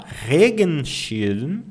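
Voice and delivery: Regenschirm drawn out with the stress on Re; very slow stress. Shown as a demonstration. Teacher models word stress slowly for learners. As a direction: stretch Regenschirm, lean on Re. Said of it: "Eu coloco o acento aqui no ponto de entonação".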